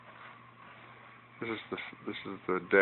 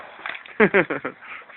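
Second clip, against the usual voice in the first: exasperated laugh; 9 semitones higher, 3 dB louder than usual. Real, not acted. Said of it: "Sorry, folks".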